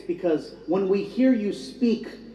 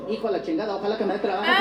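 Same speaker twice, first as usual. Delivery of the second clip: fast, unbuttoned Spanish venting; parking lot voice; much faster than usual. Acted. Applicant cursing in the parking lot, casual Spanish venting. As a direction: drop the polish and vent in Spanish. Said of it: "then you get to the parking lot".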